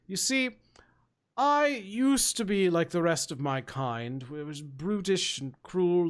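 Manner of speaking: genteel ogre storyteller with deliberate pauses; refined ogre, confessional. Acted. Ogre telling a newcomer his past, refined and measured.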